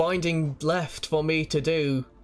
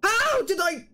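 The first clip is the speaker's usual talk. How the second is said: stunned disbelief; 13 semitones higher than usual. Real, not acted. Disbelief after the big win.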